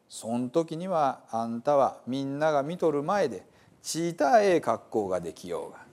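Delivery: Gospel told in lively Hiroshima dialect; dialect story reading. Acted. Reading the Bible to listeners as a dialect storyteller. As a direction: tell it like a hometown storyteller.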